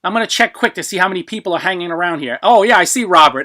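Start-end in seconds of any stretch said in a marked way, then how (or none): none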